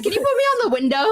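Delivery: high mock voice. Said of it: "She's like".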